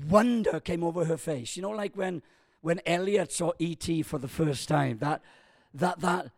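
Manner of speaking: usual speaking voice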